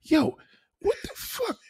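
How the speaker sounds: an incredulous squeal of what the fuck; shocked outburst. Retold or imitated. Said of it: "look around you and say".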